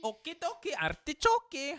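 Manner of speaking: squeaky high game-voice mimic, echoing the game; high mock Okie dokie. Streamer echoing the game's greeting in high mock voice. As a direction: chirp it way up like the game's cartoon voice. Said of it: "I like saying that so much".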